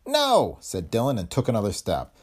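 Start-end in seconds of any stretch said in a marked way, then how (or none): 0.1-0.6 squeaky no. A narrator's reading. Dillon defies the gun.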